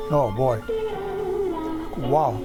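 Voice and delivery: normal talking voice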